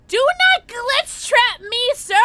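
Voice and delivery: high, loud, slow playful scold; mock scolding yell. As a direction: drag out every word at the monster.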